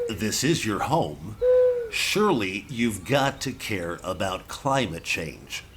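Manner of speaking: slow, deliberate questions; slow reporter questions. Acted. Reporter slowly questioning an owl, deliberate pace. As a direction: ask each question slowly and patiently.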